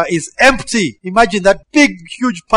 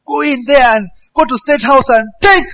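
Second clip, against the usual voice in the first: higher, louder teasing order; playful mock divine command; 8 semitones higher, 5 dB louder than usual. Preacher imagines God's order to loot, playful and raised. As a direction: joke it as God's order to you. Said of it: "if God gave me such a command".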